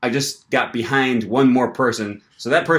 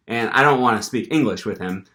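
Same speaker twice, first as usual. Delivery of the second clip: ordinary talk, nothing marked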